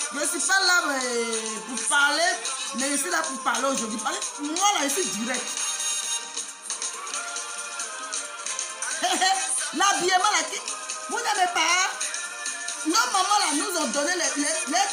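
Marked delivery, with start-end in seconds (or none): 5.5-9.7 long airy unvoiced laugh; breathy laugh. Real, not acted. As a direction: let out a soft breathy laugh. Laughs after own remark.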